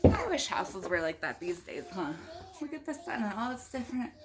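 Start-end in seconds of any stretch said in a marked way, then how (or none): none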